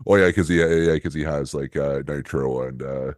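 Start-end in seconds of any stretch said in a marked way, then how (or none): none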